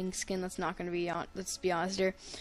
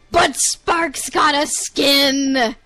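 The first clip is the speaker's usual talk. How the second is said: slow, loud, big announcement; excited reveal; 4 semitones higher, 8 dB louder, much slower than usual. Real, not acted.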